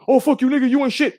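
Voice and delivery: high, jeering mock taunt; mocking H Money. Imitating a rival insulting him in a debate, high mock jeer. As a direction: play the loudmouth trash-talker, hammed up. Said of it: "like, H Money".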